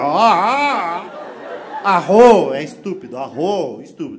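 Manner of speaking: put-on grave Japanese-accented insult, burst on arro; serious mock Japanese. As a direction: wind up slowly then snap the insult. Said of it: "ele vai virar super sério".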